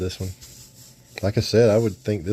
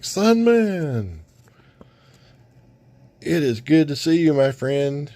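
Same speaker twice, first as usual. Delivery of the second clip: high, bright call of welcome; glad greeting; 7 semitones higher, slower than usual. Retold or imitated. Heroes welcoming Sunman, high glad greeting. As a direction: greet an old friend with joy. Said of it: "they're both like".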